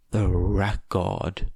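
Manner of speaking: plain reading voice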